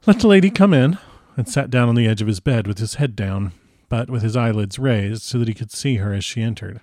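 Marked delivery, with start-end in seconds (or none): 0.1-1.0 higher and louder, cheerful invitation; a patient eagerly calling the visitor in. Acted. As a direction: call her in with bright eagerness. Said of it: "he said cheerfully".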